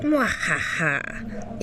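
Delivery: slow, low evil cackle; drawn-out villain's mwahaha; sneering panto villain laugh. Acted.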